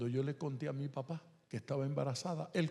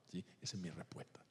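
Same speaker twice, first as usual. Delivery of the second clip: soft whispered closing line; 7 semitones lower, 13 dB quieter, faster than usual. Real, not acted. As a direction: whisper the final verdict. Closing his answer softly.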